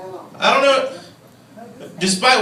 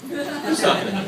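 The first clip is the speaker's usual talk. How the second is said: high, confused call; mock bewilderment; 8 semitones higher, 4 dB quieter than usual. Comedian calling out to someone he can't see, mock bewildered. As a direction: ask the unseen voice, pitched way up. Acts confused at unseen caller.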